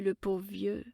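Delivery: usual speaking voice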